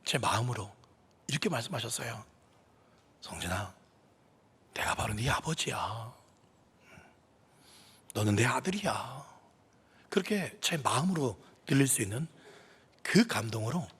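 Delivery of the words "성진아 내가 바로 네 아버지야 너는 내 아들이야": much softer, low and hushed; still small voice. Retold or imitated. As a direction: speak it as a gentle inner voice. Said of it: "세미한 음성으로". (하나님이 마음속에, 세미하고 조용한 음성으로)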